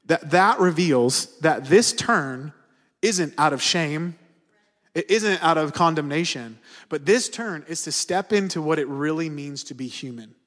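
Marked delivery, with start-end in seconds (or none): 0.1-8.8 slow emphatic preaching. Acted. Preaching at full force.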